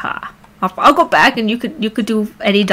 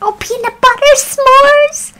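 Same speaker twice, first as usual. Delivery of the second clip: very high squeaky scout voice; Girl Scout. Pretend. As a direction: pipe up, hammed-up cookie seller. Girl Scout cookie flavours voiced.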